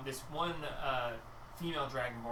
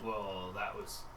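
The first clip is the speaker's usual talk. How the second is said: awkward understatement; 6 semitones lower, 5 dB quieter than usual. Acted.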